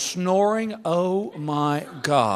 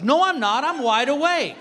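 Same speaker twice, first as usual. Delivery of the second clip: indignant wife; 7 semitones higher, 3 dB louder than usual. Retold or imitated. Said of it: "She goes".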